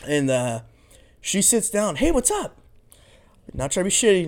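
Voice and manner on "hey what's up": much higher, chirpy mock hello; imitating her greeting. Mimicking the date sitting down, much higher mock greeting.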